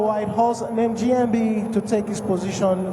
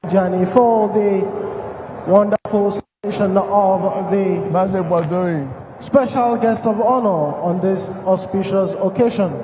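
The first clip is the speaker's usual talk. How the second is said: loud formal MC address; ceremony announcer; 9 dB louder than usual. Acted. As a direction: announce it to the whole square.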